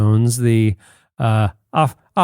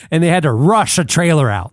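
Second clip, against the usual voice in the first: usual speaking voice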